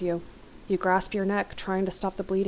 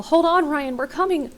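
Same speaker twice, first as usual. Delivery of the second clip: high, loud urgent call; yell; 9 semitones higher, 6 dB louder than usual. A narrator's reading. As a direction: yell to your trapped son. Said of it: "into the crease and yell".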